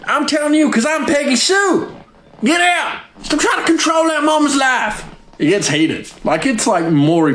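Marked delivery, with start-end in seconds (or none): 0.1-5.0 high, put-on voice of a feuding hillbilly daughter; mock TV family row. Vlogger mimics a reality-show daughter's squabble, high mock voice. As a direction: ham up a daughter yelling at her sister.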